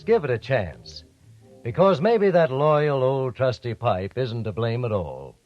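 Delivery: ordinary talk, nothing marked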